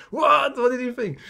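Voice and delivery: high-pitched, eager disbelief; imitating your own thrilled surprise; retold astonishment. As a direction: act out a stunned yelp, then press for the verdict. Retelling eager surprise at friend's news.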